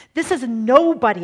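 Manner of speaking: This is a nobody proclaimed loud, stress on is; loud emphatic repeat. Shown as a demonstration. Preacher driving the point home, loud proclamation. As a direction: preach This is a nobody at full force.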